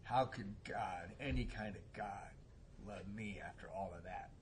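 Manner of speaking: soft, halting, weighed-down quote; understated retold anguish. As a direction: quietly imitate a broken man, pausing between phrases.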